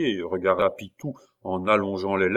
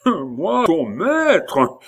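higher, louder, offended; indignant cry; 8 semitones higher, 6 dB louder, much slower than usual. A narrator's reading. Abbé offended at being called master. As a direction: cry out in outrage. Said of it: "s'écria l'abbé".

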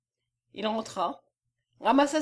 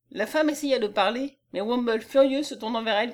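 usual speaking voice

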